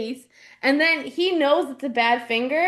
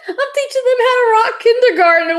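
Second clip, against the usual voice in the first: higher, louder, laughing while pretending to be indignant; laughing mock outrage; 9 semitones higher, 6 dB louder, faster than usual. Host pretending to confront the cop grandma, laughing and high. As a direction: play it up as a fed-up preschool teacher, cracking up.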